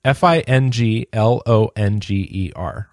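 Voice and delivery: letters spelled one by one, slowly; spelling it out. Shown as a demonstration. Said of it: "How does it spell?".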